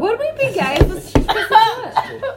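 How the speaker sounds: laughing. Real, not acted. Giggling question about Kristen Stewart.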